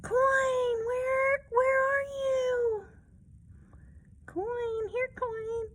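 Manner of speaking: very high kitten voice; high, slow searching call; calling for the coin. Acted. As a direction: coax it out, searching.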